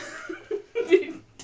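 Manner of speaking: croaky frog-like throat noise; constricted throat croak. Acted. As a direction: squeeze out a croaky throat sound. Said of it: "that's a frog constriction voice".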